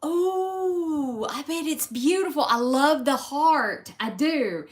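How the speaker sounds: high, loud, thrilled gushing; excited gushing to a viewer; gushing delight. Real, not acted. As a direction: squeal with delight at the compliment. Gushing over viewer's comment on heart.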